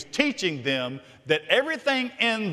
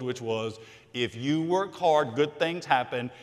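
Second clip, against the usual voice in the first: usual speaking voice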